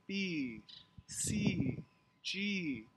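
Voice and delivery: "B", "C", "G" each said apart with a falling tune; downward intonation. Shown as a demonstration. Teacher modelling letter names for learners, downward intonation. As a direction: let each letter name, "B", "C", "G", glide down. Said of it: "there's a downward intonation with each letter".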